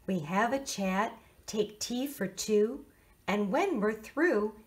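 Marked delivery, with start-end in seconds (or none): none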